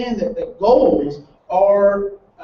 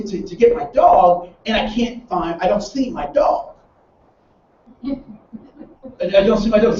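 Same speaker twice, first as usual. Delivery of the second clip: usual speaking voice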